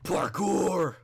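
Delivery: high loud yell of parkour; shouted battle cry. Pretend. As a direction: yell it as you leap. Shouting while jumping.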